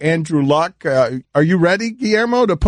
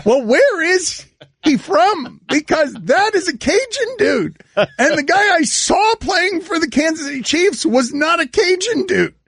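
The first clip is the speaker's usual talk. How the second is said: high-pitched, baffled disbelief; incredulous; 10 semitones higher than usual. Real, not acted. Radio host stunned by how the quarterback sounds, incredulous. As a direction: protest in astonishment, voice pitched way up.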